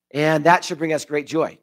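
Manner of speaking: ordinary talk, nothing marked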